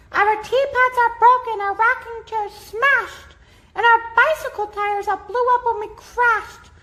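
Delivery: very high, small and upset; a speck-sized mayor reporting disaster; squeaky troubled mayor voice; tiny distressed mayor. A narrator's reading. Mayor voice held.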